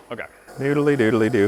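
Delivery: ordinary delivery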